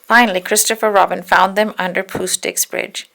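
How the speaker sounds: ordinary delivery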